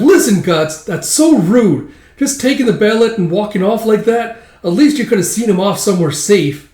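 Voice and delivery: high, loud telling-off; indignant little elf; scolding elf. Acted. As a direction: nag him in a high sidekick voice.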